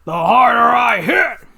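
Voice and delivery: loud, punchy shout; shouted threat. Acted. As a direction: yell it as you strike. Hero's battle cry.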